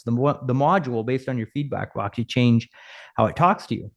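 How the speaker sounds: ordinary delivery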